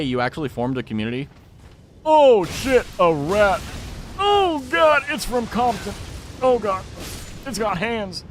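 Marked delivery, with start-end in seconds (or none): 2.0-8.2 high, loud cry of alarm; hammed-up fright, pitched way up; mock panic. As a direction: play at panicking over a rat. Mock panic at spotting a rat.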